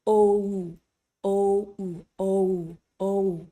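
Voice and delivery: slowed O gliding into an OO sound; O pulled apart into two vowels; drawn-out diphthong O. Shown as a demonstration. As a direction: stretch O so both vowels show. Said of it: "Let's slow that down".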